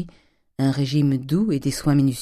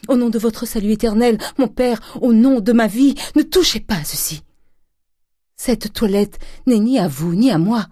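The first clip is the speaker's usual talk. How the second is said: high, loud, impassioned supplication; pleading Eugénie, desperate; 8 semitones higher, 5 dB louder than usual. A narrator's reading. Daughter on her knees begging her father, desperate pleading. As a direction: beg with mounting urgency, then steady slightly.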